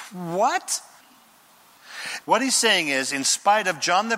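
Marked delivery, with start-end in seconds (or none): 0.1-0.8 mock surprise. Mock incredulous reaction.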